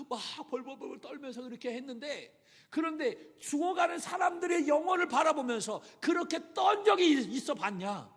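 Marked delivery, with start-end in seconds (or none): 2.7-8.1 loud, high fervent sermon shout; impassioned preacher's outcry; preaching at full force. Acted. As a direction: thunder it at the congregation. Preaching climax.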